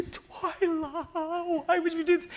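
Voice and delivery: high whiny plea to his wife; high pleading whine. Retold or imitated.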